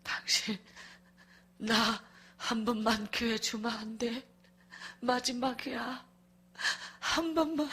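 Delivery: hushed, slow, halting begging; imitated tearful plea. Husband begging wife for one chance, quiet tearful plea. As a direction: plead softly between breaths. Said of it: "하면서 우리는 막 계속".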